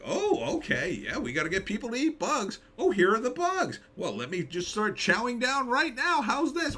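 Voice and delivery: high, giddy mock voice eagerly chowing down; mocking Nicole Kidman. Streamer imitating Kidman eating bugs, high mocking voice. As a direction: ham it up as a shill eating bugs.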